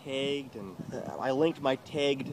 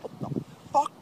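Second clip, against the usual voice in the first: retold outrage; 4 dB louder than usual. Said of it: "I'm like".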